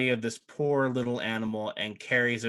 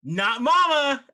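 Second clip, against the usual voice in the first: high, loud, slow shriek; cling and wail like a needy goblin; squealing little monster, pitched way up; shrieking creature; 7 dB louder, slower than usual. Acted. Redcap clings to mama.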